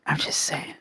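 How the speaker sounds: barely voiced, fading whisper; the teasing hint repeated under her breath. Real, not acted. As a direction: breathe it out almost silently.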